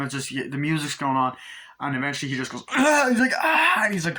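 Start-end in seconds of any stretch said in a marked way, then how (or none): 2.7-3.1 high, loud imitated scream of pain; mock scream. Imitating the tortured cop's scream, high and loud. Cop screams while being cut. 3.4-3.8 high, loud imitated scream of pain; mock scream. Imitating the tortured cop's scream, high and loud. Cop screams while being cut.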